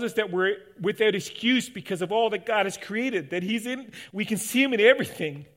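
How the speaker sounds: fervent pulpit delivery; preaching. Acted. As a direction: open the sermon at full force from the pulpit. Opening at full preaching force.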